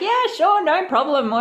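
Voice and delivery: high-pitched, easygoing reassurance; breezy agreement. Retold or imitated. Speaker replays brushing off a woman's thanks, breezy. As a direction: toss it off high and lightly.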